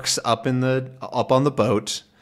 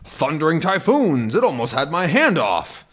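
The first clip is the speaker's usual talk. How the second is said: somewhat higher, agitated exclaim; shaken outburst; 6 semitones higher than usual. Acted. Haddock after nearly losing his hand, agitated outburst. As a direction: blurt it out still rattled.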